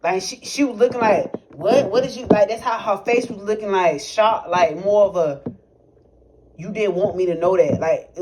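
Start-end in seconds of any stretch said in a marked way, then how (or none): none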